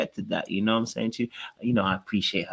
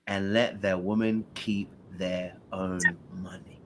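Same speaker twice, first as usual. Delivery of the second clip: dramatic whisper; 3 semitones lower, 7 dB quieter, slower than usual. Shown as a demonstration. Host reads debate statement.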